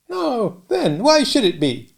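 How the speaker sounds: put-on high kindly old dame voice; a cottage dame fussing over a boy. Old dame offers the sooty boy milk, high and kindly.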